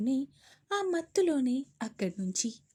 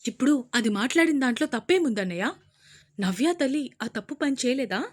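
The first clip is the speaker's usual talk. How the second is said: loud, fast shout; 7 dB louder, faster than usual. Acted. Sister shouting at her brother, loud. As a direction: yell back at the brother. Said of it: "అరిచారు Sumitra గారు".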